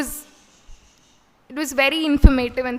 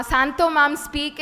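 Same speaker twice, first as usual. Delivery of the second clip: ordinary talk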